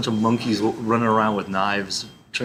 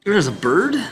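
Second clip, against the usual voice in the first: high and louder, rising on "bird"; startled "bird" swooping up; surprised question; 8 semitones higher, 6 dB louder than usual. Real, not acted. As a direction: caught off guard by the bird. Sudden confused reaction.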